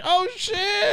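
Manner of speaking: very high, squealed astonishment; high-pitched thrilled surprise; amazed reaction. Retold or imitated. Own amazed reaction retold.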